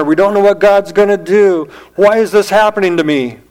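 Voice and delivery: loud, high, pressing sermon voice; preaching at full force. Acted. Preacher pleading to the congregation, at full force. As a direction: cry it out over the congregation.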